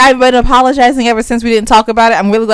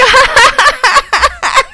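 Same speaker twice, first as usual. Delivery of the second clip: a big high laugh bursting out; high burst of laughter. Real, not acted. Laughing at crying husband.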